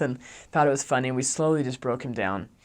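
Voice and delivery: plain reading voice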